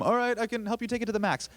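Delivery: high, loud chirpy store greeting; forced retail greeting. Retold or imitated. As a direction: chirp it like a cheerful cashier on autopilot. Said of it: "I'd be like".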